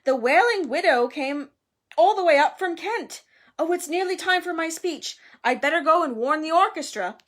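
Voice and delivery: loud, high, puffed-up host; proud. A narrator's reading. Nick boasting before his speech, proud. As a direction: boast of guests with busy self-importance. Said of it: "said Nearly Headless Nick proudly".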